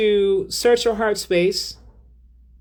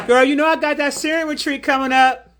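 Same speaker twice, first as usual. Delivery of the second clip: excited; 7 semitones higher than usual. Real, not acted. Excited announcement to viewers.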